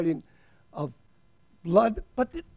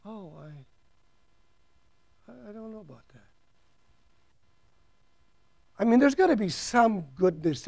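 very hushed, unsure murmur of protest; protesting listener; 3 semitones higher than usual. Pretend. Preacher voicing a listener who insists people have goodness, very hushed. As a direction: mumble it like someone unconvinced.

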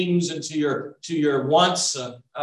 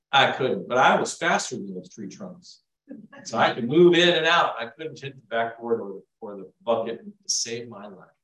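ordinary talk, nothing marked

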